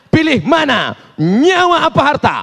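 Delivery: high, forceful robber's demand; perampok mengancam. Acted. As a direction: threaten the victim with a choice. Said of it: "perampok itu membawa pisau yang tajam". (gertakan perampok bernada tinggi)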